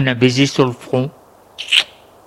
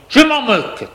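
higher-pitched defiant retort; mild narrated yell, pitch raised; crying out; 9 semitones higher than usual. A narrator's reading. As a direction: throw it back defiantly. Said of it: "il lui crie".

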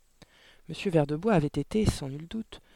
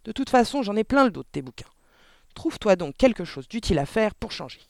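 raised, louder, fed-up scolding; barked angrily; 6 semitones higher, 5 dB louder than usual. A narrator's reading. Father fed up with daughter's books, barking. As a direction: scold with exasperation, high and loud. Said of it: "aboya monsieur Verdebois".

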